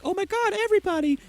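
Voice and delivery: high, mock dismayed Firefly voice; mock Firefly gasp. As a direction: squeak it out like an overdramatic heroine. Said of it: "Firefly's like".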